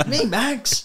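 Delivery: high put-on voice; mock innocent reply. Max naming himself at the office door, high mock voice. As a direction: send it right up high, playfully.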